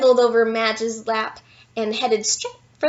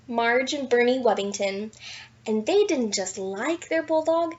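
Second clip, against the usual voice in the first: ordinary delivery, nothing marked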